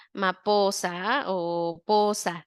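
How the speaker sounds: put-on "maposa o posa" dragged out slowly, syllables clipped like a toddler; slow child mispronunciation. Shown as a demonstration. A teacher imitating toddler speech for parents, slow and childlike. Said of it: "ya no te dicen".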